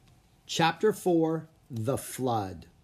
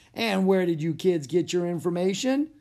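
lightly voiced jolly policeman, higher; 6 semitones higher, 4 dB louder than usual. A narrator's reading. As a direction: play an amused officer humouring kids. Jolly policeman questions the children.

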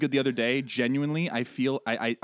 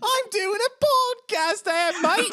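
much higher, slow squeaky British voice; mock British; 5 dB louder than usual. Host parrots the Tom Holland bit in a squeaky British accent.

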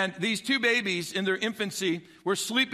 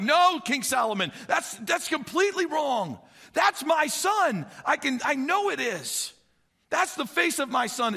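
desperate protest; 7 semitones higher than usual. Retold or imitated. Mother protesting to King Solomon, desperate. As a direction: fight for your son before the king.

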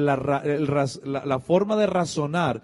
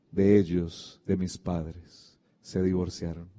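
real sadness, low and subdued; a little sad; 4 semitones lower than usual. Real, not acted. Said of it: "cuando cuento esto me pongo un poco triste".

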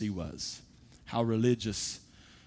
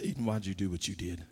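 put-on soft, low, serious father to his little girl; a hushed, disappointed question; father gently scolding his daughter, low and soft; quiet gentle rebuke; 5 semitones lower, 6 dB quieter than usual. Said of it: "I say".

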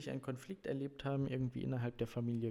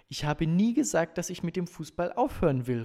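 loud, higher-pitched protest; indignant call; 6 semitones higher, 11 dB louder than usual. A narrator's reading. Brother denies wanting to quit football, loud protest. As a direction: call out a firm denial. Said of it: "rief mein Bruder Jason".

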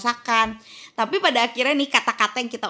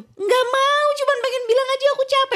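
much higher-pitched, petulant refusal; whiny protest. Retold or imitated. Wife refusing her partner's coaching, whiny protest. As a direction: replay a fed-up whine at your partner.

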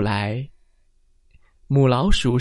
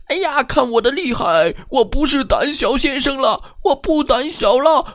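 higher, proud battle cry; finally brave and boastful; 胆小先生得意; triumphant timid man; 12 semitones higher than usual. A narrator's reading. Man fights back.

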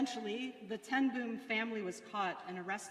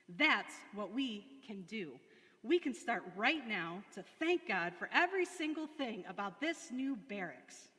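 ordinary talk

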